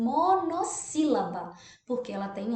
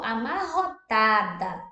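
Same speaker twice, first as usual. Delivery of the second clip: "amarrotada" slowly split a-mar-ro-ta-da; "amarrotada" in deliberate separate beats; syllable by syllable; 5 dB louder, slower than usual. Shown as a demonstration. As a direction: sound out "amarrotada" slowly, dividing between the two R's. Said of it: "Tem que separar".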